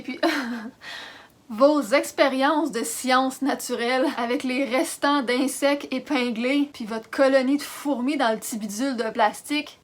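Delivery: usual speaking voice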